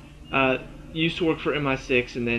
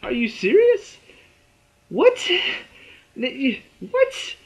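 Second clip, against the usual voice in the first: high-pitched, halting disbelief; incredulous, pitch way up, words breaking off; stunned confusion; 11 semitones higher, 4 dB louder, much slower than usual. Retold or imitated. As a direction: sputter as if blindsided by a twist. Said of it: "I'm so confused".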